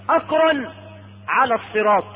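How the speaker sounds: normal talking voice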